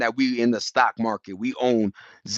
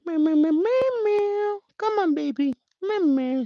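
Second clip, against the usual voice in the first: put-on much higher meowing baby voice to the cat. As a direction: coax the kitten with tiny high meows. Calling his cat to play.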